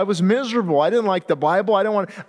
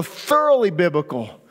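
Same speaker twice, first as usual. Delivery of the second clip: normal talking voice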